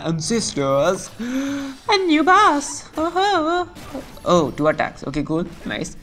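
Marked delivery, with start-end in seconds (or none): 1.2-4.0 high, drawn-out gasp of awe; slow squeaky wonder; mock amazement. Gasps at a new boss appearing.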